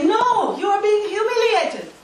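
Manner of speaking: high, emphatic contradiction; acted insistence. Social worker contradicts the beaten wife, insistent.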